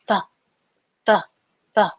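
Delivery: "the" with the E sound cut off; clipped the. Shown as a demonstration. Teacher repeating the clipped "the" for the class. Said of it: "it's a slight difference".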